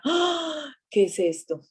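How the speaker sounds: high startled shriek, then a half-voiced what is this. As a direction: play the jump of fright at a scary stranger. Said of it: "y yo decía".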